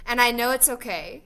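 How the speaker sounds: ordinary delivery, nothing marked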